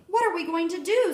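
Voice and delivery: high, upset plea; a mother at her wits' end, voice up high; worried cry. A narrator's reading. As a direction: ask it close to tears. Distraught mother in story.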